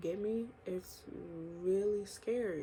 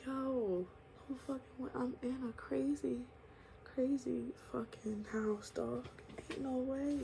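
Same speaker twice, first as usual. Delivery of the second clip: hushed, breathy disbelief, pitched up; stunned whisper; 5 semitones higher, 6 dB quieter than usual. Retold or imitated. Retelling his shock at seeing his mom, hushed whisper. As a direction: whisper it like you can't believe your eyes.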